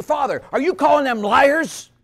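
high-pitched mock indignation; retold indignant challenge. Woman confronting the priest, indignant and high. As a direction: challenge him like an affronted parishioner.

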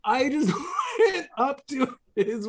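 high burst of laughter mid-story; laughing. Real, not acted. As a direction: break up laughing. Laughing while retelling.

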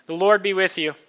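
priest's call to the people; liturgical call. Acted.

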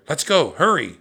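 high, loud hurry-up call; excited urging. A narrator's reading. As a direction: urge him to get moving. Girl urges her brother on.

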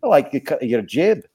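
low, menacingly friendly Scottish local; Scottish accent. Acted. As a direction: glare through a Scottish burr. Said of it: "I love the Scottish accent and jokes".

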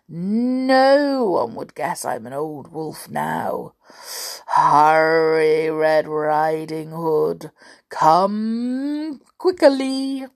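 drawn-out storybook wolf voice; sly wolf in disguise, very slow; scheming wolf. Acted. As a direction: stretch the words out slowly, swelling upward.

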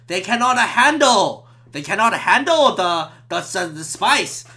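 put-on slow, halting Cantonese-accented explanation; mock Cantonese accent. Chinese friend explaining the foreigner to staff, Cantonese accent. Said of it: "that's a Cantonese accent".

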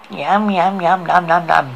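savoring yums with smacking lips; lip-smacking yum sounds. A narrator's reading. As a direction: smack your lips over tasty food. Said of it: "smacking his, her lips".

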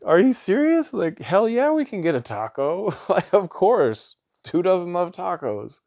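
high, loud, excited retold reply; thrilled disbelief. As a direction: re-enact a delighted, incredulous yes, voice leaping up. Delighted dad answers taco request.

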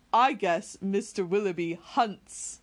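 slow, knowing, teasing; sly teasing. A narrator's reading. Edward teasing Marianne about Willoughby, slow and sly. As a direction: savour the joke slowly. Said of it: "said in a whisper".

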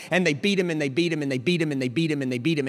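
quick rhythmic litany at ordinary volume; fast, restrained repeated phrase; rapid repetition. Real, not acted. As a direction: roll the blows out quickly, held back. First, restrained repeated beating.

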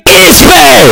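explosive, drawn-out roar of the surname; odd accented surname shout. Pretend. Host announcing donor Juan José, surname roared in an odd accent. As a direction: blast the surname out absurdly loud. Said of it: "¿Por qué ese acento?".

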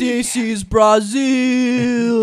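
loud, drawn-out fake Brazilian accent; attempted Brazilian accent. Player answers a request for Brazilian, loud hammed-up Brazilian accent. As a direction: belt out a bad Brazilian accent, stretching the words. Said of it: "Hit me with a Brazilian".

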